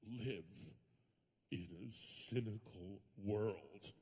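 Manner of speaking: slow, low, hushed movie-star sincerity; milk each word like a weepy film hero; mock tearful Tom Cruise. Comic imitating Tom Cruise's tearful speech, slow and hushed. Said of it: "tears in his eyes, and he says".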